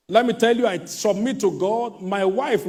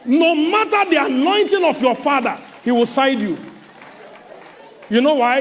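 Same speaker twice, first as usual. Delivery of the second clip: high and loud preaching; preacher at full force; 6 semitones higher, 5 dB louder than usual. Acted. Preacher warning against running to parents, preaching loud and high. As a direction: lift the voice and preach it hard.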